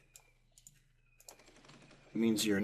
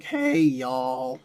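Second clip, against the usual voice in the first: a hammed-up Southern drawl; y'all it like a Gulf states local; a lightly done Southern y'all; mock Southern accent; 4 semitones higher, 8 dB louder than usual. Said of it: "And it's like".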